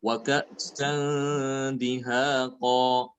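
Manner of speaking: slow level chant, nasal held in "ka'san"; ikhfa before dal. Shown as a demonstration. Teacher gives a dal example, slow recitation. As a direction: stretch "ka'san" and long vowels of "dihaqa". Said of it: "bertemu dengan huruf dal ya".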